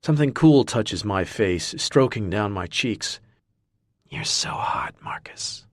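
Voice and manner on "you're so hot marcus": seductive whisper. Acted.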